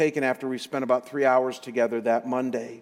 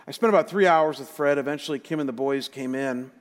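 ordinary delivery

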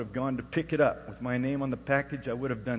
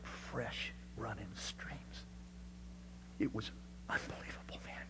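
awed whisper; 2 semitones lower, 14 dB quieter than usual. Real, not acted. Overcome recalling his conversion.

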